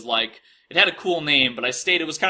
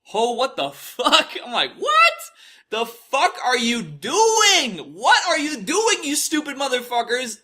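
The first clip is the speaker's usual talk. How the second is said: high, loud, disbelieving shout, stretched out; incredulous outraged yell; 10 semitones higher, 3 dB louder, slower than usual. Retold or imitated. Retelling his reaction to the news, a high incredulous yell. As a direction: yell it in stunned outrage, voice jumping up. Reacting to reporters calling him shooter.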